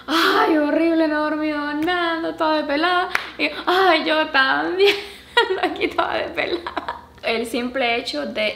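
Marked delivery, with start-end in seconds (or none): 0.1-3.1 high, loud playful moaning; mock-exhausted mom griping; exaggerated complaining. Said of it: "entrar en la quejadera de que". 3.7-7.0 loud mock lament breaking into laughs; commiserating complaint. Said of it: "entrar en la quejadera de que".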